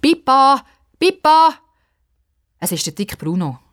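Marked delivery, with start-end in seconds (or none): 0.0-1.5 high, loud calling out; boy calling a friend from afar. Acted. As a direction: wave and call her name. Bruno calling Pippa.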